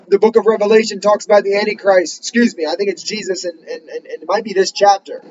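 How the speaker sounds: ordinary talk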